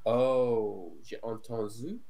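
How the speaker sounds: usual speaking voice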